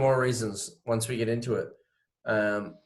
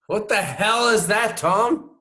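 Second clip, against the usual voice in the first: amazed; 9 semitones higher, 3 dB louder than usual. Real, not acted. Reacts to absurd story.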